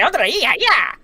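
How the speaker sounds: high-pitched cartoon Mexican cry; Speedy Gonzalez. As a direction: whoop it out excitedly in a cartoon Mexican accent. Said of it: "That's Speedy Gonzalez".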